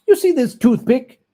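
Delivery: put-on high, loud anxious old miser; Scrooge voice. Scrooge challenging the ghost, high and loud. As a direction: blurt it out to break the ghost's stare.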